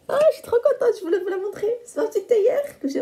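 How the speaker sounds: high, squealing with delight; thrilled, pitch way up; excited. Real, not acted. Showing the gift, delighted.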